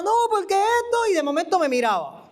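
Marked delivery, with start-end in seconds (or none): 0.0-1.0 a higher mock prophet, rushing on; lightly ape the prophet's pitch; imitating the prophet. Retelling the prophet's sermon to the church, mock higher voice. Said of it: "y él empezaba".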